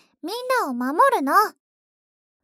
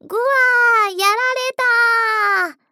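high, loud hammed-up villain cry; defeated monster scream; 5 semitones higher, 8 dB louder than usual.

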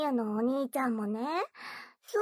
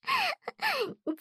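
mocking giggle. Mocking her obedient brother.

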